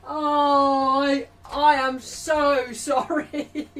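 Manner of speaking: slow, drawn-out apology collapsing into laughter; helpless laughter. Real, not acted. Streamer apologising to Arsenal fans, breaking into laughter. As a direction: try to apologise as real laughter takes over. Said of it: "I'm not laughing at Arsenal".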